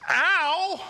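high, loud yelp; loud yelp of pain. Retold or imitated. Dad poked in the eye, sharp high yelp. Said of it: "I'm like".

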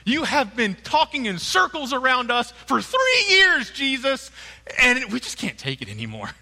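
high, loud thrilled disciples; excited disciples. Retold or imitated. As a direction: burst with relief. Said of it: "they're so excited".

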